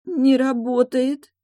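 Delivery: high, slow whimper; drawn-out plaintive girl; whiny, tearful. A narrator's reading. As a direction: whine it out, close to tears. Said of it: "плаксиво констатировала девушка".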